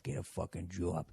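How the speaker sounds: put-on very soft, low Boston-accented mutter; muttering to yourself. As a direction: practise the accent under your breath. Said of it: "just muttering to yourself".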